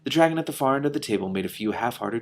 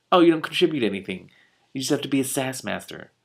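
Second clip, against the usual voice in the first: teasing; 3 semitones higher, 5 dB louder than usual. Real, not acted. Reader mocking the sarcastic dragon.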